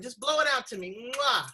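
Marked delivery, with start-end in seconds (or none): 1.2-1.5 loud smacking air kiss. Pretend. Host to guest sending a virtual kiss, a loud smacking air kiss. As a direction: blow a big kiss into the mic. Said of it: "give me a virtual one".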